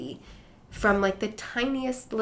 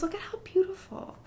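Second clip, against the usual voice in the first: soft, much higher voice of wonder; hushed mock awe, pitched way up; imitated awestruck grandmother; 13 semitones higher, 6 dB quieter than usual. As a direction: coo it like an enchanted grandmother. Grandmother voiced admiring the bug.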